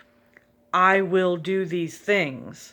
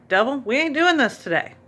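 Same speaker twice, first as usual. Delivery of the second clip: firm rebuke; 6 semitones higher, 3 dB louder than usual. Retold or imitated. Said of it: "said out loud in the shower".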